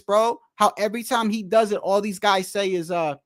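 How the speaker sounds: ordinary delivery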